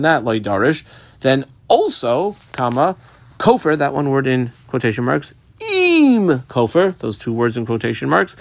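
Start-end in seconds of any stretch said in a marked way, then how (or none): 5.6-7.0 very slow, high, drawn-out "Im"; stress on Im. Shown as a demonstration. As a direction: hold "Im" long and high before Kofer. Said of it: "emphasis on the word Im".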